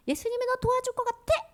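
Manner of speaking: high-pitched, mocking acted question; taunting Satan voice. Satan questioning whether Jesus will help, taunting voice. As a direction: sow doubt in a high little voice. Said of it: "이런 말에".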